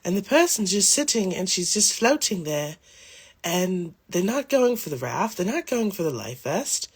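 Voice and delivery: imitating the English TikTok psychic describing the vision, lightly accented. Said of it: "this little English lady, she's like".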